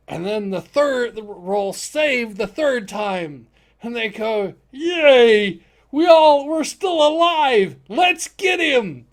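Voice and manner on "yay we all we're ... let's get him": high, loud, slow cheering; triumphant cheer. Acted. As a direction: celebrate surviving the breath. Party cheers surviving.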